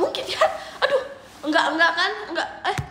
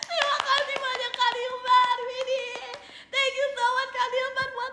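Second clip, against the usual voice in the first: giggling through the thanks, pitched up; laughing thanks; 7 semitones higher than usual. Real, not acted. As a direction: thank them while laughing with joy. Thanked for a big gift. (tertawa senang sambil berterima kasih)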